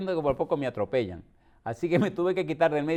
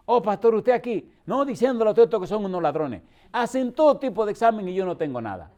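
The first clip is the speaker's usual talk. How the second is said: put-on feisty old woman; 6 semitones higher, 5 dB louder than usual. Feisty old woman greets pastor.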